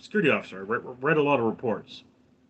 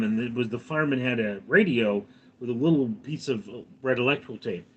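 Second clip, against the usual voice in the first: usual speaking voice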